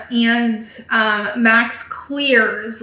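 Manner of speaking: ordinary delivery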